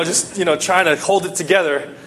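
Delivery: ordinary delivery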